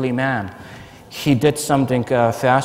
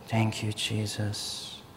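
soft, slow murmured thanks; murmured prayer; 4 semitones lower, 13 dB quieter, much slower than usual. Retold or imitated. Priest praying over him, soft and slow. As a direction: barely voice it, slowly.

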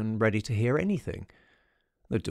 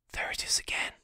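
tense whisper; 5 dB quieter than usual. A narrator's reading. Beaumont warning the narrator of the sound, whispered. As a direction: whisper it so nothing hears you. Hearing the hoof in the dark.

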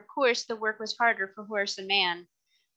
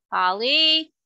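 calling out loud across the house; shouting for Polly; 6 semitones higher, 7 dB louder than usual. A narrator's reading. Jerry calls his wife to come, shouting. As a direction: call her name loudly to fetch her. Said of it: "he shouted".

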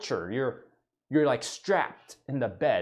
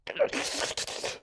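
long unvoiced sigh; heavy sigh; 9 dB quieter than usual. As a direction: breathe out a weary, defeated sigh.

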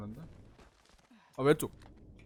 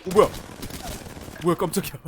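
sudden loud startled yelp; 5 semitones higher, 13 dB louder, much faster than usual. Real, not acted. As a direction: jump out of your skin. Startled by enemy.

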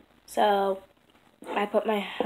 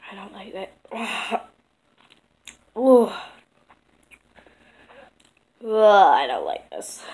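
sour face; 11 dB louder, slower than usual. Real, not acted. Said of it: "This is the top sourest".

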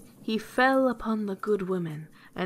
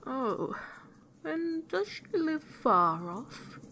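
high, slow, drawn-out wolf question; sly wolf; 6 semitones higher, slower than usual. A narrator's reading. Wolf asks the girl about grandmamma, slow and sly. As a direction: stretch it out, feigning mild interest.

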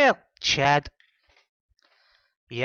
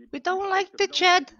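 put-on much higher whiny girl voice; high complaining customer voice. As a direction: whine it like a fed-up customer.

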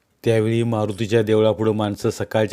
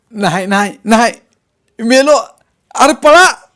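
high, loud panicked wail; terrified cry; 13 semitones higher, 11 dB louder than usual. A narrator's reading. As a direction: cry out in pain and terror. Beaten man cries in panic. (घाबरून आरडाओरडा)

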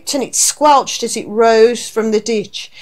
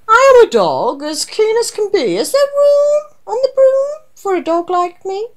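high-pitched eager dog voice, stretched-out question; 11 semitones higher, 4 dB louder than usual. A narrator's reading. Dog asks the witch for room on the broom, high and eager. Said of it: "then eagerly said".

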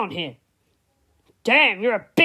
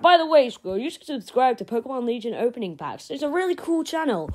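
quick plug; 2 semitones higher, much faster than usual. Acted. Quick channel plug aside.

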